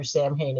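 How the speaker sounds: "Samhain" as SAM-hayn; wrong pronunciation. Shown as a demonstration. Expert begging writers not to use the name, wrong pronunciation. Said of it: "not pronounced Samhain".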